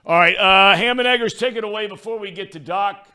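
loud, high-energy segment call; hype intro. Acted. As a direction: announce it big like a radio host. Host calls in segment intro.

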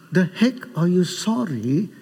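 ordinary talk

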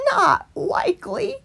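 high scornful rabbit; rabbit refusing. A narrator's reading. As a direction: toss it off high. Stickle refuses.

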